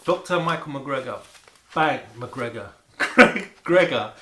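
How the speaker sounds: loud playful name call, laughing; laughing announcement. Real, not acted.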